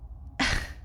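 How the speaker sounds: bitter laugh. Acted. Bitter laugh after pleading.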